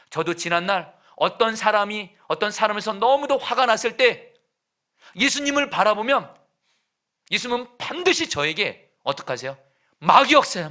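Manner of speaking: loud, high preaching; fiery preaching. Acted. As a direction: preach it out with full force. Preaching at full force.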